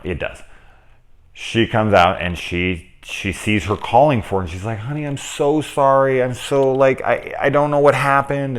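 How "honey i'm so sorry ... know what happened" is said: higher pleading voice, lightly acted; mock tearful apology. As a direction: beg forgiveness in a raised, sorry tone. Wife voiced begging forgiveness.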